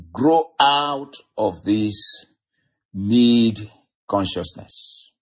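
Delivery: very slow, deliberate preaching; drawn-out words with weighty pauses; slow for emphasis. Real, not acted. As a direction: spell it out slowly so it sinks in. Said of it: "I'm saying it slowly".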